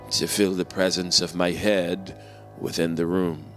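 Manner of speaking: ordinary delivery, nothing marked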